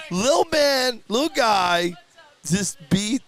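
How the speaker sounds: high, loud thrilled call of the winner; excited calling. Real, not acted. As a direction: shout his name in delight. Calling the kid's upset win.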